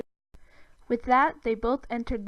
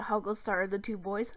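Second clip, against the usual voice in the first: ordinary delivery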